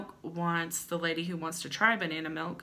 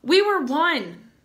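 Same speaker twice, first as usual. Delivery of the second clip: louder, higher, slow and grand; dramatic declaration; 8 semitones higher, 11 dB louder, slower than usual. Acted. Reading the lovesick ad to viewers, grandly dramatic. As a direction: declare it like a soap opera lover.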